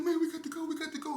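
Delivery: urgent panicked push to leave; panicking. Retold or imitated. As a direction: accomplice panicking in getaway car. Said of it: "He panicking".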